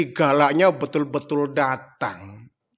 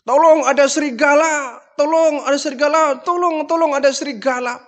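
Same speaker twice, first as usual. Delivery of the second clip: mock cry for help; 9 semitones higher than usual. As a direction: fake alarm, shout it out. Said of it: "dia iseng lagi".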